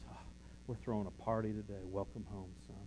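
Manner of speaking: soft, hushed joy of a father welcoming his son home; quietly beam as you welcome him home; tender father welcome. Retold or imitated. God the father softly welcoming home his lost son. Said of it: "And God says".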